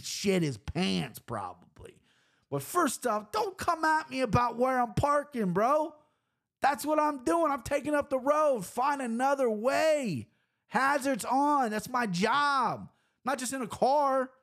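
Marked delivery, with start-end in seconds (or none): 2.5-14.3 higher, slow and defiant rant; heated rant. Real, not acted. Delivery driver telling off drivers, raised and defiant. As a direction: tell them off, daring them to complain. Ranting at drivers about parking.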